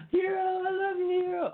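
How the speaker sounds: very high, gushing pet voice; cooing to a dog. Real, not acted. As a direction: squeal it like talking to a puppy. Cooing over beloved dog.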